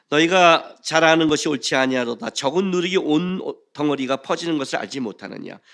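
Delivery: ordinary talk, nothing marked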